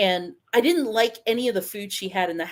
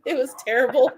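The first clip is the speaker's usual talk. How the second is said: ordinary talk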